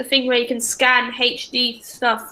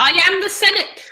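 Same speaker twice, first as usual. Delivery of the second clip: pompous senator; 7 semitones higher than usual. Retold or imitated. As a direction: declare it like a Sith emperor. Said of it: "you sound like your man, the Senate guy".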